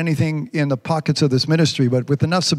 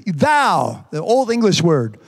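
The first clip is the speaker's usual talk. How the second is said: raised, sermon cadence; preaching; 6 semitones higher than usual. Acted. Pastor preaching to his church, full sermon voice. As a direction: preach it to the congregation.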